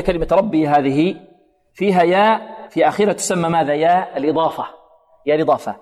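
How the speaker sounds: normal talking voice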